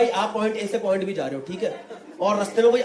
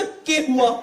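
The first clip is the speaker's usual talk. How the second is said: mock tough Haryanvi; much slower than usual. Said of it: "जैसे ही मैंने बोला ना".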